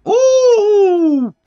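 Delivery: ordinary talk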